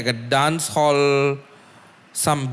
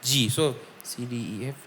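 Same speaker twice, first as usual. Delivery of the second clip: counting notes under breath; 7 dB quieter, slower than usual. Shown as a demonstration. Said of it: "counting keys under breath".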